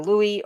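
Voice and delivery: Louis with a silent S, LOO-ee; pronunciation option. Shown as a demonstration. Unsure streamer trying a viewer's name, Louis said as LOO-ee. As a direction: say Louis as LOO-ee, no final consonant. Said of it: "how to pronounce your name".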